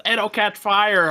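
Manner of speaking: high, slow mocking echo; mocking quote. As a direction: parrot the warning back mockingly. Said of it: "and everybody says".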